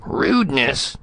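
high, affronted echo of the word; indignant bark. A narrator's reading. Ambassador affronted by his subordinate's excuse, barking. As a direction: bark it back as an outraged question. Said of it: "Spradley barked".

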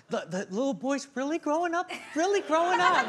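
sweet proud-mom voice, rising at the end; doting mom's comment. Retold or imitated. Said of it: "like, a comment to [name]".

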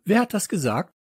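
high, affronted demand; indignant cry. Acted. Teacher hunting for whoever insulted her, indignant cry. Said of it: "rief Popo".

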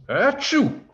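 high, loud mock sneeze; acted sneeze. A narrator's reading. Wizard sneezes mid-spell, loud acted sneeze. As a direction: fake a big high-pitched achoo. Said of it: "Then Wizard sneezed".

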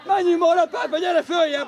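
loud, insistent yelling from the sideline; urging shout. Real, not acted. Coach tells players to move up, urging shout. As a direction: shout to push them forward.

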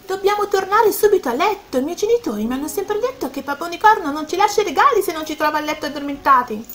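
high, rushed alarm, not a full yell; alarmed, nearly shouting. Acted. Violet warns friend they must be in bed, alarmed. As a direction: blurt out a sudden worrying thought. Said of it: "improvvisamente gli balenò un pensiero e quasi urlò".

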